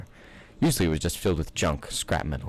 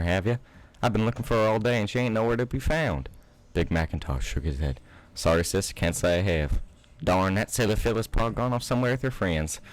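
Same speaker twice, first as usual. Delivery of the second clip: a hint of Southern twang throughout; Southern accent. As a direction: play it as a Southern farmhand. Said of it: "I'm gonna stop this accent".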